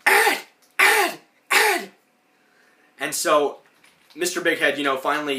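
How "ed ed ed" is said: much higher, louder calling voice; Bev calling. Retold or imitated. Bev calling Ed in his daydream, much higher calling voice. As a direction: call his name from his daydream. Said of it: "you hear Bev going".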